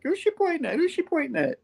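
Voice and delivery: very high mock falsetto; puzzled comic-book heroes. Baffled heroes watching someone flirt, puzzled falsetto. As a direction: squeak the question twice in falsetto. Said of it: "Spidey and She-Hulk are still like".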